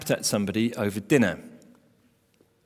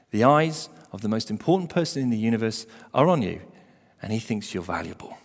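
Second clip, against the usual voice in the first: usual speaking voice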